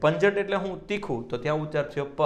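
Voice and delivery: ordinary talk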